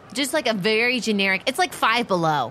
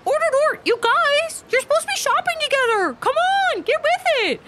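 very high, bossy squeal of a voice; high-pitched chivvying, hurrying the pair along; scolding Cecily; faster than usual. Acted. Cecily tells the two to shop together, high-pitched scolding.

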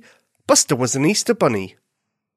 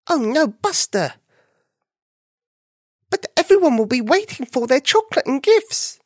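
high, worried little bunny; 11 semitones higher than usual. Acted. Alarmed bunny replies.

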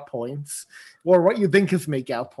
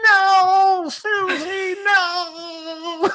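very high, mock-anguished wail; Gingy; much slower than usual. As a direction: wail it like a tiny cookie losing his love. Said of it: "and Shinji's like".

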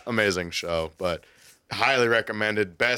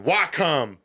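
higher-pitched Southern comeback; Southern response; 6 semitones higher than usual. Retold or imitated. Son answering his summoning father, mildly Southern. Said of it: "in the true Southern response".

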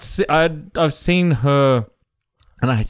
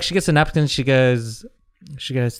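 ordinary delivery

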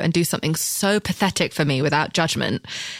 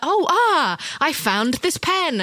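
pretending; 8 semitones higher, much slower than usual. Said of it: "pretending like".

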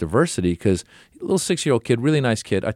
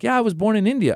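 little son voice; 12 semitones higher, faster than usual. Retold or imitated. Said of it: "he's like".

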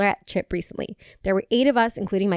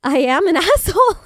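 laughing quote; 8 semitones higher, 6 dB louder than usual. Retold or imitated. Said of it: "this man was like".

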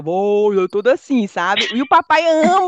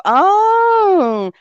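mock realization; 10 semitones higher, 5 dB louder than usual. [name]'s realization.

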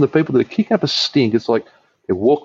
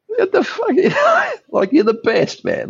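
laughing disbelief; slower than usual. Real, not acted. Laughing at his mate's teasing.

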